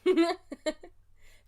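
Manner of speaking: loud high laugh. Real, not acted. Partner laughing at the cat ranking idea.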